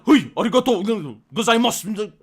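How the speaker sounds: mock Japanese. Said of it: "people in Japan".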